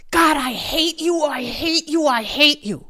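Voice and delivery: high, loud, furious outburst; full-voiced angry shout, pitched high; yelling at God. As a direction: yell it at the top of your lungs. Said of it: "I was yelling at the top of my lungs".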